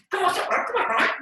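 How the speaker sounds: high, loud mock begging; impatient pleading. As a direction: nag the silent friend, hammed up. Begging Mike to answer.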